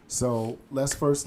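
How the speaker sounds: normal talking voice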